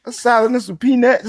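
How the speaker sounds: high aunt voice with a laugh; imitating auntie. Aunt's answer voiced, laughing.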